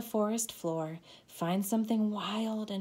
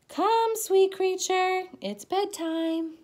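high, loud coaxing call; sing-song bedtime call; 12 semitones higher, 7 dB louder than usual. Acted. Mother calling child to bed, high loud call. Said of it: "You beat the word like a drum".

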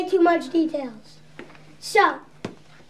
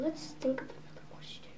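fast, unvoiced whisper; whispered aside; 4 semitones lower, 19 dB quieter, faster than usual. Real, not acted. Host whispers to herself while picking a question. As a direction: whisper it to yourself.

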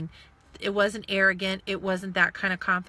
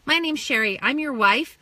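louder, higher mock-bright sarcasm; sarcastic; 5 semitones higher, 8 dB louder than usual. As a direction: introduce yourself sarcastically to your husband. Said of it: "I go".

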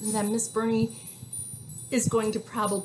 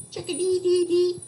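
high, louder chirpy bird song; chickadee call; 9 semitones higher, 7 dB louder than usual. Shown as a demonstration. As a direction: chirp it like a little songbird. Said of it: "That's what he sounds like when he's singing".

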